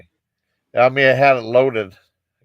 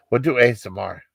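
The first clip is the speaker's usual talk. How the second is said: ordinary talk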